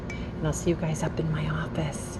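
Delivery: soft whispered aside; whispering. Real, not acted. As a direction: whisper it to the camera. Whispered sign-off.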